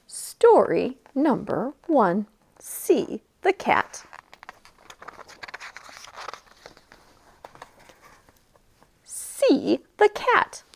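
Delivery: slow, drawn-out picture-book reading; reading to children. A narrator's reading. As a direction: read each word slowly for little ones.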